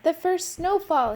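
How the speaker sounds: relieved excitement. Acted. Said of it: "he said".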